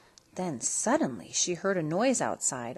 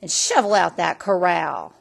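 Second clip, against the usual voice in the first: loud final order; yell; 6 dB louder than usual. A narrator's reading. Stepmother yelling chores at Cindy, loud. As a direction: finish the command with a shout. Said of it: "she would yell".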